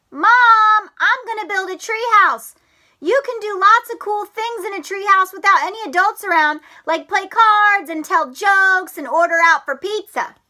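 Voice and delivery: put-on high, loud eager frog kid; excited Froggy. Froggy telling Mom about his treehouse plan, high and loud. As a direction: chatter like a thrilled little frog.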